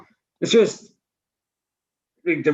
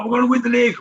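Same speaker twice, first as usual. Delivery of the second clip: mock fan hype; 10 semitones higher, much faster than usual. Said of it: "it's like".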